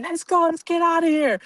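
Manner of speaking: high, pressing get-out call; urgent escape. Retold or imitated. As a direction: rush them out the door. Fleeing the store with friend.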